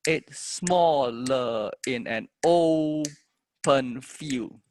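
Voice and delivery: very slow, stressed syllables held long on the beat; metered verse. Shown as a demonstration. Teacher trying another fit of the meter, drawn-out recital. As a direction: stretch "made", "smaller" and "open" to fit the beat. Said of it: "we can always".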